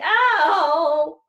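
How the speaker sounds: loud yelp of pain. Acted.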